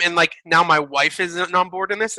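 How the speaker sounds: usual speaking voice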